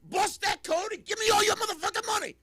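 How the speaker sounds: high, loud street thug yell; crackhead yell. Acted. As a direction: scream it like a crazed robber. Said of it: "crackhead ... comes walking in and says".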